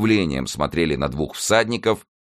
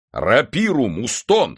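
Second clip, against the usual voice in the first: high, raised-voice summons; calling an order; 7 semitones higher, 5 dB louder, slower than usual. A narrator's reading. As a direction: call out to the servant. Master calls an order to servant. (громкий приказ слуге)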